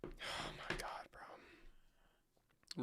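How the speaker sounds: stunned whisper, slow and unvoiced. Real, not acted. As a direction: let it slip out as a breathless whisper. Stunned reaction, very soft.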